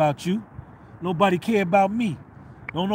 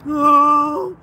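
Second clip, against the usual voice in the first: loud high mocking wail; 5 semitones higher, 8 dB louder than usual. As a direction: wail like a pitiful crybaby in mockery.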